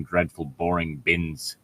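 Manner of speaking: normal talking voice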